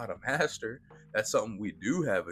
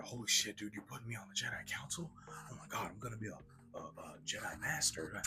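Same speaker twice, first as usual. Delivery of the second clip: hushed, fast, starstruck whisper, much softer; awed excited whisper; 2 semitones higher, 9 dB quieter, faster than usual. Retold or imitated. Anakin dazzled by a council seat, awed whisper. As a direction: gush under your breath in disbelief. Anakin awestruck at promotion, retold.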